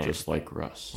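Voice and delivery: slow, soft deadpan jab; deadpan sarcastic. Pretend. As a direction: say it dry and quiet. Sarcastic refrain.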